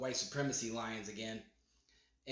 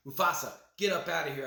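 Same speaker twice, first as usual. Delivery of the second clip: quick, louder retold order; Scar; 3 semitones higher, 5 dB louder, faster than usual. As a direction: send a friend away in a hurry. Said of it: "so he's like".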